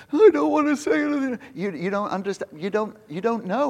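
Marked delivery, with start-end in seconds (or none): 0.1-1.4 higher, whiny timid protest; nervous mock whine. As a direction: play a scared singer begging off. Michelle shaking in her boots.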